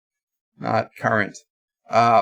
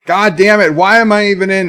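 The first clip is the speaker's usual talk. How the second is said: higher, louder exasperated outburst; mildly pissed-off complaint; retold anger; 5 semitones higher, 5 dB louder than usual. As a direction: curse in retold frustration. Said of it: "I was really pissed".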